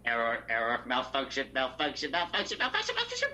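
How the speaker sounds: malfunctioning robot, pitch climbing; glitching robot. Acted.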